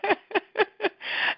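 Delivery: breathy high disbelieving laugh; a short, amazed laugh; incredulous laugh. Real, not acted. Laughing at the absurdity.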